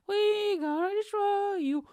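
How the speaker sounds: very high, drawn-out sing-song threat; cartoonishly high mock menace; mock media threat. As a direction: squeal the threat slowly, hammed-up. Voices media attack, exaggerated second take.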